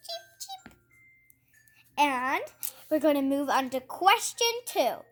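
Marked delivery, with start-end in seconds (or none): 0.1-0.7 very high, soft chick peeps; chick cheeping. A narrator's reading. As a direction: peep like a tiny chick. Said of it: "say the chickens".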